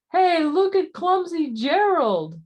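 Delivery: high, loud jeering taunt; mock him from above; sneering. A narrator's reading. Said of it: "the animals all sneered".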